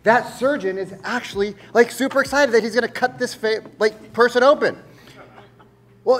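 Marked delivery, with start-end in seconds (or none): none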